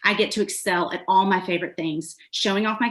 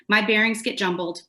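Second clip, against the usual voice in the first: ordinary delivery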